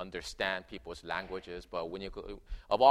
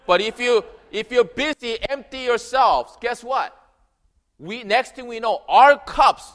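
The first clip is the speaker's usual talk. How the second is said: loud, high, fervent preaching; a pastor building to a big point; preaching at full force; 9 semitones higher, 11 dB louder than usual. Acted. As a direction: proclaim it to the congregation. Preaching opening of sermon.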